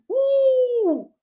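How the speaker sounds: high, drawn-out woo; long high whoop of amazement; exclaimed woo. Real, not acted. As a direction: let out a high whoo. Exclaims over that dude.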